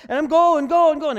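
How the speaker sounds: loud, high, frantic pushing on; mock striving shout. Preacher mocking believers who won't stop striving, loud high shout. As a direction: shout it like you can't stop running. Mimicking someone pushing on relentlessly.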